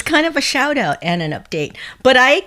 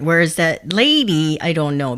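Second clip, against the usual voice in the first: ordinary talk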